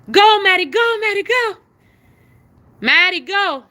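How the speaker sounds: loud, high repeated commands; shouting at the dog to go pee; calling the dog. Real, not acted. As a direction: keep urging the dog out loudly.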